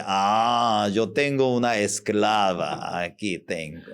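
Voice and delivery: put-on a spoiled child gloating over a slave mother; smug child. Preacher voicing a spoiled child, low and smug.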